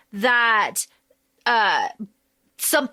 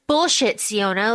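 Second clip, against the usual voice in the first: ordinary talk, nothing marked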